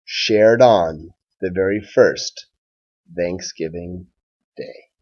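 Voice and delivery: put-on slow, even rhyming read-aloud for children; story read-aloud. A narrator's reading. Teacher reading a Thanksgiving rhyme to his class, gentle storytime read-aloud. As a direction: read it like a picture book at storytime.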